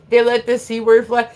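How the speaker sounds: ordinary talk, nothing marked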